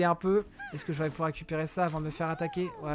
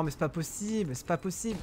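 ordinary talk, nothing marked

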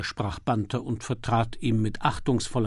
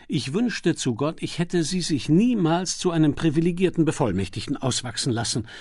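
bitter; 5 semitones higher, 4 dB louder than usual. Acted. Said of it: "sagte Lord Peter bitter".

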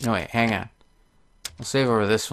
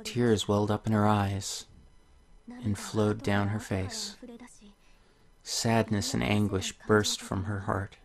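soft, gentle storybook reading; story narration; 8 dB quieter than usual. Acted. Reading a tale to viewers, soft storybook voice. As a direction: read the fairy tale softly to the viewers. Reads the game's story aloud.

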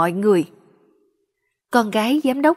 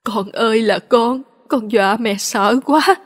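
bursting into tears. A narrator's reading. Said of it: "khóc òa lên như trẻ nhỏ".

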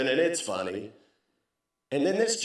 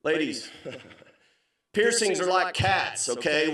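ordinary talk

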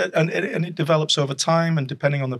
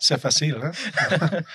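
laughing French aside; 3 semitones higher, slower than usual. Real, not acted.

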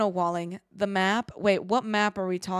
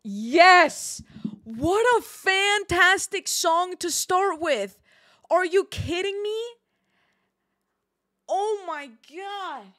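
high, loud, drawn-out delight; thrilled outburst; 9 semitones higher, 5 dB louder, slower than usual. Real, not acted. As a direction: gush loudly over a favourite. Thrilled when music starts.

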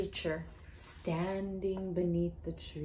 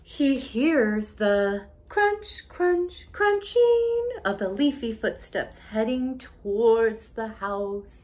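much louder, slow crunch, crunch; spooky sound words; 5 semitones higher, 14 dB louder, slower than usual. Acted. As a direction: stretch the crunches to build dread.

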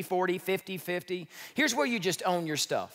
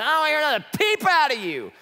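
high, loud mocking scold; condescending scold; 10 semitones higher, 7 dB louder than usual. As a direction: ham up a condescending parent. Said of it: "Somebody says".

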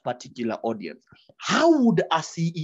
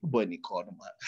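mocking street voice; 9 semitones lower, 9 dB quieter than usual. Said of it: "your enunciation will say that".

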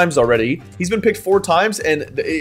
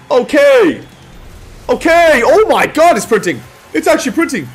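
high rising hype; excited shouting; 8 semitones higher, 4 dB louder than usual. Real, not acted. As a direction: build up to a loud yell. Big multiplier lands.

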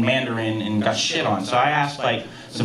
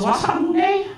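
high, emphatic mimicked Chinese accent; Big Chen; 8 semitones higher, much slower than usual. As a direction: spell out the translation in a Chinese-accented boss voice. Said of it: "I'm just imitating his actual voice".

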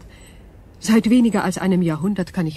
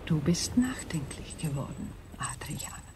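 gentle hushed observation; soft whisper; 3 semitones lower, 10 dB quieter than usual. Acted. Berenice whispering gently to a thoughtful Adrian. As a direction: whisper it tenderly. [name] speaks softly to Adrian.

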